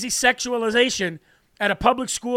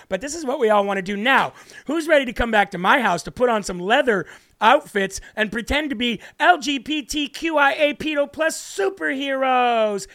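mocking affected voice; 3 semitones higher than usual. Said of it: "it's clearly a choice to talk like that".

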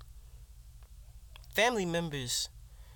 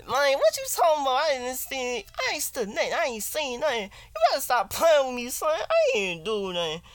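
higher, louder defensive denial; higher accused guy; 10 semitones higher, 6 dB louder than usual. Acted. Acting out a skit, accused friend denying stealing jelly loudly. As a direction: deny it like a wrongly accused friend. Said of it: "I can be an actor right now".

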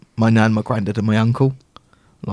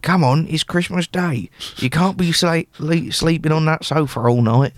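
nan voice; 4 semitones higher than usual. Retold or imitated. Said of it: "my nan would ... go".

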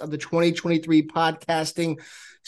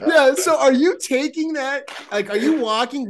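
laughing; 8 semitones higher, 3 dB louder than usual. Real, not acted. Laughing, high, far.

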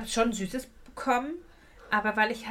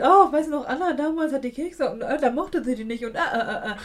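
loud, high, laughing relative retelling; mocking relatives; 3 semitones higher, 6 dB louder than usual. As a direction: ham up a relative at a family party. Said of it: "jedes Mal noch erzählt".

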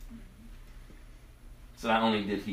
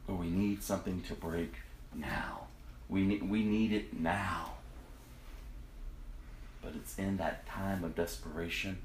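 soft devotional; 5 semitones lower, 12 dB quieter than usual. Acted. Quiet devotional reflection.

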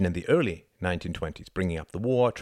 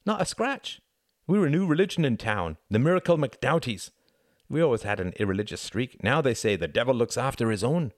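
lightly voiced villager, higher-pitched and marvelling; animated storyteller; 5 semitones higher, 3 dB louder than usual. Hart tells Tom of his family's return, lightly animated. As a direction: tell it as an unbelievable bit of family luck. Character recounts family's improbable survival.

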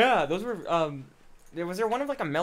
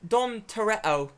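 slow, mocking British "Dom Toretto"; old lady's British accent; 2 semitones higher, 3 dB louder, slower than usual. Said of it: "Fucking old lady just goes".

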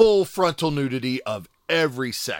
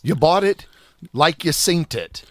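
put-on English accent; 3 semitones higher, 6 dB louder than usual. Quoting the Snatch scene.

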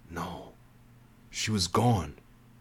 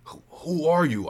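choked out; 8 semitones higher than usual. A narrator's reading. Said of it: "I choked out".

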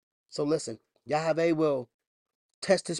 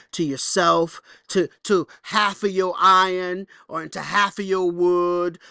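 raised, driving sermon delivery; preaching; 4 semitones higher, 7 dB louder than usual. Acted. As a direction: preach it out to the congregation. Preaching at full force.

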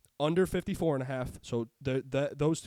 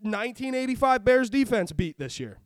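louder, heated listing of quarterbacks; angry rant; 4 semitones higher, 7 dB louder than usual. Real, not acted. Ranting to his co-host about the Patriots defense, angry and loud. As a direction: rail against the overrated defense. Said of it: "Rant over".

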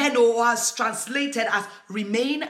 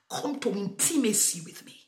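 breathy intimate whisper; whispered call to intimacy; 5 dB quieter than usual. Acted. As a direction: whisper it like a lover's invitation. Jesus voiced intimately.

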